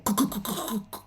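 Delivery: a soft, breathless stutter of shock; shocked stammer. Retold or imitated. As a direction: stammer on the first sound, too stunned to finish. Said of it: "Ils bégayent, quoi".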